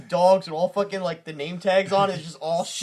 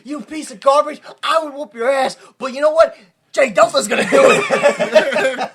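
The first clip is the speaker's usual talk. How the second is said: a touch louder, higher heel manager; mock manager trash talk; 6 semitones higher, 6 dB louder than usual. Manager mocking the opponent, mild heel trash talk. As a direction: sneer it like a cocky manager. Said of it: "and is like".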